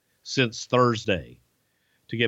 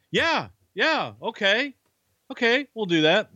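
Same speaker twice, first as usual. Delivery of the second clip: much higher, rattled yes-man; flustered Coach; 10 semitones higher than usual. Retold or imitated. Coach rattled by the fake flight news, high and flustered. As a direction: scramble to agree, voice up high.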